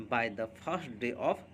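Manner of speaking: slow dictation. Shown as a demonstration. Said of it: "या".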